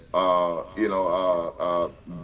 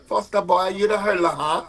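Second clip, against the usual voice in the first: lightly imitated Caribbean lilt, pitched higher; a playful nod to his island teammates teasing him; island teammates' accent; 9 semitones higher than usual. Teammates from the islands ribbing Foster, light Caribbean imitation. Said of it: "because they're like".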